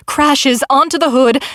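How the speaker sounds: plain reading voice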